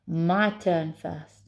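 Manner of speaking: slow, insistent Scottish voice; Scottish accent. Acted. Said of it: "a Scottish accent".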